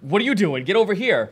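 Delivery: mock bossy shout; scolding order. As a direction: call over a straggler sharply. Acts guard ordering a stray golem.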